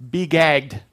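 "Be gagged" barked out loud; the literal meaning, said forcefully; forceful command. Shown as a demonstration. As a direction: slam down "gagged" as a hard order. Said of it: "what he's actually saying literally is".

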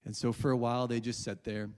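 ordinary delivery

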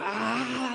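high, drawn-out excited "ahh"; mock squeal of excitement; enthusiastic squeal.

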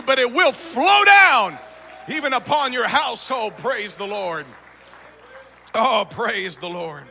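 rising to a loud preaching climax; building preaching. Acted. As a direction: build and shout it to the rafters.

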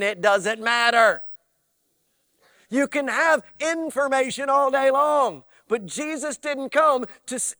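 booming pulpit delivery; full-force preaching. Real, not acted. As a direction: preach it hard to the room.

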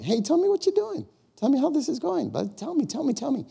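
high, eager, pleading "tell me, tell me"; eager prompting. Shown as a demonstration. Showing the church how to ask, eager and high. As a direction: coax them with bright curiosity. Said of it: "Just ask them something".

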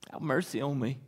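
low, very soft; hushed plea. Acted. Beggar's plea echoed after the shouting, hushed. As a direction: drop to a quiet, low murmur.

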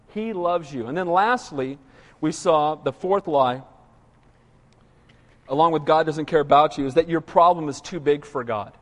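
ordinary delivery

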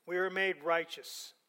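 normal talking voice

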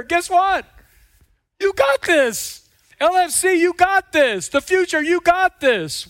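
high, loud, building proclamation; preaching at full force. Real, not acted. Preacher rallying the congregation, loud high. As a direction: declare it to the church.

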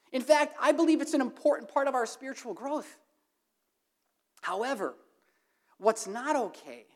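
ordinary delivery

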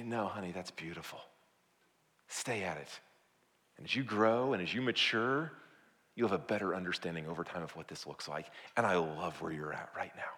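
soft, low parental encouragement; tender reassurance. Acted. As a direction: gently reassure a small child. Gentle parent encouraging a child.

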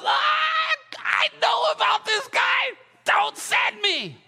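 high-pitched, drawn-out mock yell; panicked protest. Ananias begs God not to send him, hammed-up high wail.

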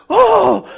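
loud, high gasp of horror; hammed-up shriek of alarm; freaked-out gasp. Woman approached by a stranger in a parking lot, freaking-out gasp. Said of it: "she's freaking out".

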